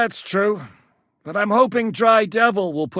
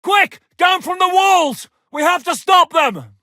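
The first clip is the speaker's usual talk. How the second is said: much higher and louder, raising the alarm; urgent shout; 8 semitones higher, 6 dB louder than usual. Acted. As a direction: yell the men off the walls. Battle alarm, ordering troops.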